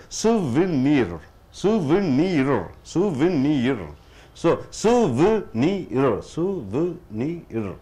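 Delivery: souvenir slowly as soo-vuh-nee-r, each sound separate; syllable by syllable. Shown as a demonstration. Teacher sounding out a word for students, slow syllable by syllable. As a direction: draw out souvenir in measured beats, soo, vuh, NEER. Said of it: "Four sounds are there".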